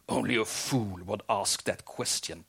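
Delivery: plain reading voice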